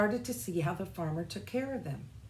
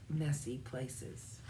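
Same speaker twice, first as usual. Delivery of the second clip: usual speaking voice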